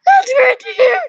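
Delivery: chirpy high mother reply; mother's voice. Acted. Mother answering her son's school report, high and loud. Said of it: "It was good".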